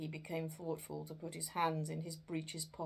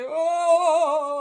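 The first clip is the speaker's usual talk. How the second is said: high, loud, drawn-out wail; ghostly cry; 11 semitones higher, 19 dB louder than usual. Acted. Ghost crying out to frighten Scrooge, a loud wail. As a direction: let out a long spectral howl. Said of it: "the spectre raised a cry".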